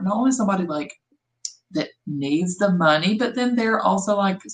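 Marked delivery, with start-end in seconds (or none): none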